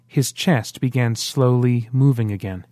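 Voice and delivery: plain reading voice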